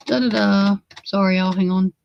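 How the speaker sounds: ordinary talk, nothing marked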